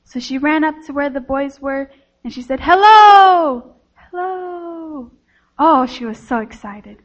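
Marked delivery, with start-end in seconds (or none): 2.6-3.7 loud, higher-pitched long hello; excited shout. A narrator's reading. Girl shouts to raise echo. 3.9-5.1 soft, high, distant echo of a call. A narrator's reading. Echo of her shout answers.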